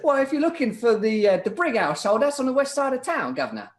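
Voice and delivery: high, cheery stage cockney; cockney vendor. Acted. Vendor points the player to the Brigg household, hammy cockney accent. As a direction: give directions like a comic London street seller. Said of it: "He says".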